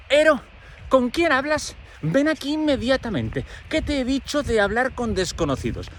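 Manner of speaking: high, sharp maternal call from inside; scolding mother. Retold or imitated. Mother calling Ero away from the window, high and stern.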